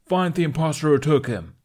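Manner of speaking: yelled. A narrator's reading. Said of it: "the captain yelled".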